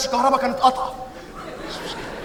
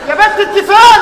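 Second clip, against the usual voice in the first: laughing call; 6 semitones higher, 7 dB louder than usual. Acted. Calling, laugh heard.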